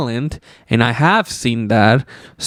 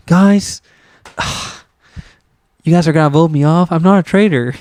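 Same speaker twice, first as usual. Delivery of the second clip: higher, fast, hammed-up wounded pleading; mock hurt plea; 4 semitones higher than usual. Mocking Tony pleading with housemates, hammed-up hurt. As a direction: whine it like a betrayed contestant. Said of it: "he's like".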